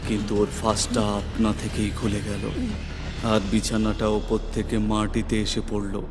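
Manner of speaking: ordinary delivery, nothing marked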